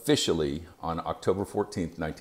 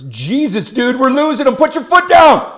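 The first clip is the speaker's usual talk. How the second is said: higher, much louder frantic yelling, climbing to the end; panicked shout; 13 dB louder than usual. Retold or imitated. Medic in the back yelling at the driver, panicked and very loud. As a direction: lose it completely, yelling in panic. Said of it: "sounding all worked up and panicked".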